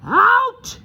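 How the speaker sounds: high, loud roar. Acted. Uncle Vernon throwing the boys out, roaring. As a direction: bellow them out of the room. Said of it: "roared Uncle Vernon".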